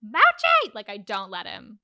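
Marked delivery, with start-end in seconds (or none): 0.0-0.7 high, loud scream of the cat's name; sudden high-pitched shout at a pet; retold scream. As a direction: yell sharply at a misbehaving cat. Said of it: "And I scream".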